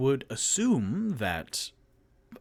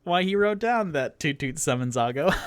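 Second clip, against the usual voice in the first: normal talking voice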